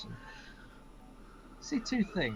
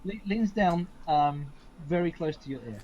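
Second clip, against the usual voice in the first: normal talking voice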